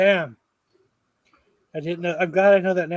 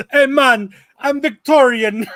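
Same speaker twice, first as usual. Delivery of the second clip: high, loud, slow fake Victorian accent; mock Victorian accent; 7 semitones higher, 7 dB louder, slower than usual. Joker answers the talk of Doom's accent, mock Victorian accent. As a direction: belt out a silly Victorian accent, drawing it out. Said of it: "talks with a Victorian accent".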